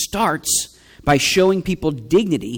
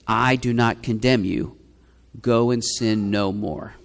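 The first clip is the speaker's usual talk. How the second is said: gentle, tender; 7 semitones lower, 4 dB quieter than usual. Shown as a demonstration. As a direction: say it like tender mercy, not judgment. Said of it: "Do you hear the difference?".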